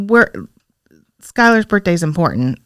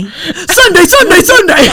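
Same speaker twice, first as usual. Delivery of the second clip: monster truck announcer; 8 semitones higher, 3 dB louder, slower than usual. Pretend. Hyped announcer parody, laughing.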